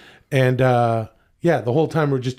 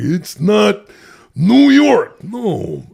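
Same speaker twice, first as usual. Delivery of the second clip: mock outrage; 7 semitones higher, 6 dB louder, much slower than usual. Mock-indignant catchphrase payoff.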